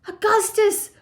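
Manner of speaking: high, loud frantic cry. A narrator's reading. Chocolate maker to greedy boy, high alarmed cry. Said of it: "cried Mister Wonka".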